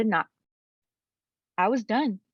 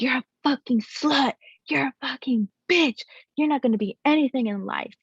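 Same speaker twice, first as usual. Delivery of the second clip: higher-pitched imitation of his abuse; mock angry rant, pitched up; retold angry insults; 6 semitones higher than usual. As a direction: hurl insults as he did, imitating his rage. Retold abuser chasing and insulting her.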